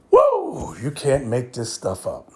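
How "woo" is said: a sudden high whoop of disbelief; high excited woo. Real, not acted. Preacher whoops at the congregation, high and excited. Exclaims after preaching point.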